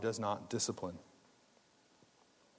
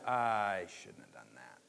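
slow, lower regretful murmur; 4 semitones lower, much slower than usual. Pretend. Believer realizing their sin, slow and regretful. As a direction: let the regret sink in. Realizing one's own wrongdoing.

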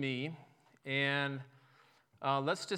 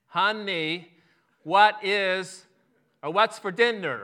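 much louder and higher, word by word; loud, slow, staccato; 5 semitones higher, 11 dB louder, slower than usual. Acted. Husband thinks his wife cannot hear him, loud slow staccato. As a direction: punch each word out slowly for someone not listening. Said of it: "louder, slower, and a little more staccato".

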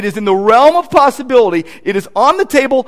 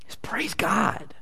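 whispered; 12 dB quieter, much slower than usual. Real, not acted. Hushed aside after the reading.

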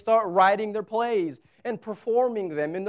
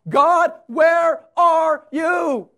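anguished crying out; 6 semitones higher, 10 dB louder than usual. Acted. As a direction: shout it up at God in anguish. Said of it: "can't you hear them crying out".

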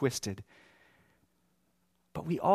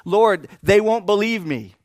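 louder, higher objection to God; protesting Moses; 5 semitones higher, 10 dB louder than usual. Acted. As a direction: plead your case at raised volume.

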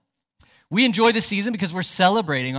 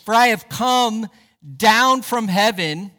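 raised, emphatic scripture quotation; quoting Jesus; 4 semitones higher, 6 dB louder than usual. Retold or imitated. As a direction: proclaim it loudly, easing off at the end. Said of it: "Jesus said".

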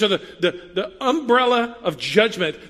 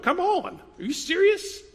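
much higher, scoffing disbelief; mock incredulous; 11 semitones higher than usual. Pastor mimics religious people scoffing at God loving the world, incredulous. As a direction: squeak out an outraged are-you-kidding.

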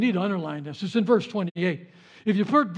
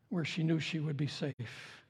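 normal talking voice

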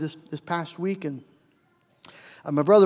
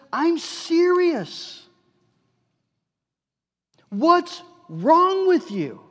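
higher, slow, drawn-out stern rebuke; stern anger; 9 semitones higher, much slower than usual. Retold or imitated. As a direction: hammer home the seriousness, slowly. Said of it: "it was evident he was angry".

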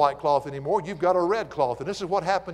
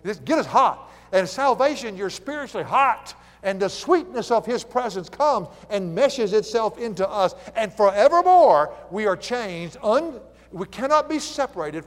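raised, higher preaching voice building in force; impassioned preaching; 4 semitones higher, 5 dB louder than usual. Real, not acted. Preacher urging the congregation to be spiritually hot, impassioned preaching. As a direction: drive it up to a loud, ringing peak. Sermon climax about being hot.

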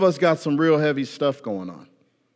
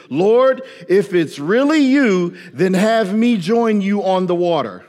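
bold prayer; 5 semitones higher, 5 dB louder, slower than usual. Retold or imitated. Preacher voicing Peter's bold prayer.

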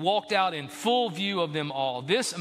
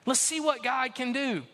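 voicing Jesus; 6 semitones higher than usual. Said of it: "Jesus says".

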